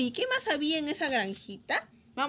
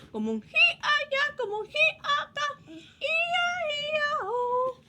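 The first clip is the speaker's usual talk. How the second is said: high whinnying sung voice; horse neigh; 12 semitones higher, 4 dB louder, slower than usual. Retold or imitated.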